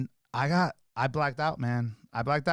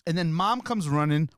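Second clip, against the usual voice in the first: usual speaking voice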